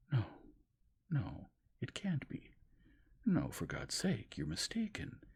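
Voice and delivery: high, loud, slow protest; anguished denial. A narrator's reading. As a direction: plead against it.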